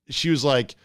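usual speaking voice

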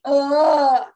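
loud, drawn-out effortful groan; straining grunt. Retold or imitated. Teller imitates the grunt that got the parents' attention, straining grunt. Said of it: "type grunt".

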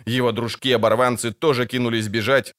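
ordinary delivery, nothing marked

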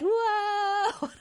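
high, held mock cry of alarm; changed narrator voice. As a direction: imitate the overacting narrator with one long high wail. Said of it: "when his voice changes".

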